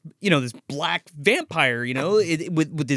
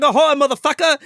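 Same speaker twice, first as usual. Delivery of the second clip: high, loud put-on holler; imitated calling out; 11 semitones higher, 6 dB louder than usual. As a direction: hail the ship like a cranky uncle. Repeating the uncle's shipboard hail.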